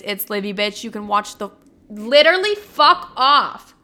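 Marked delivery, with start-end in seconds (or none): 1.9-3.8 sudden loud high snap of irritation; startled, annoyed shout; real outburst at a car. Real, not acted. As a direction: yell it at the noise outside, fed up. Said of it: "Sorry, that was, like, a loud ass fucking car".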